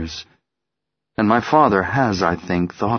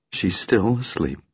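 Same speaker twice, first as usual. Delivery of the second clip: ordinary delivery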